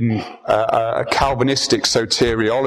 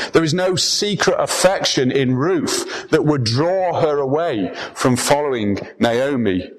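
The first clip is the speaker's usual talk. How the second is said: ordinary delivery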